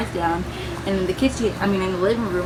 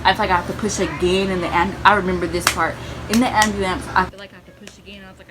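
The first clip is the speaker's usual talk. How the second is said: ordinary talk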